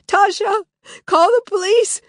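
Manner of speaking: high loud desperate call; Lily crying out to Tasha for help. Acted. Desperate cry.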